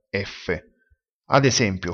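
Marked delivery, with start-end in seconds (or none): none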